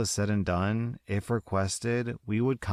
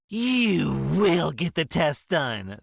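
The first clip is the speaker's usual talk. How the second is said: higher, louder, slow yell; yelling mother; 7 semitones higher, 4 dB louder, slower than usual. Acted. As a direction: yell the order at her child. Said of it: "the mama yells at her child".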